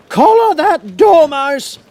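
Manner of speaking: very high, loud, slow shriek; shrieking Queen. A narrator's reading. Queen shrieks at her officers about the dormouse. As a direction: scream the order at the court. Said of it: "the Queen shrieked out".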